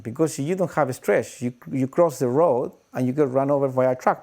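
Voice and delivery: ordinary talk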